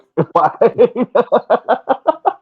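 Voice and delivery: long high laughing fit; real laughter. Real, not acted. Guest cracking up at his own what-if joke, laughing. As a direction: burst out laughing loudly.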